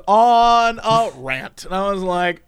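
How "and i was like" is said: ordinary talk, nothing marked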